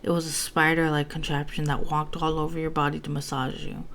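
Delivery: plain reading voice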